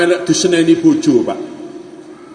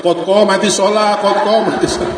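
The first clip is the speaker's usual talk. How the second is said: mock iqamah as wife; 6 semitones higher than usual. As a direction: answer back in a sing-song iqamah. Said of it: "Sing wedok jawab".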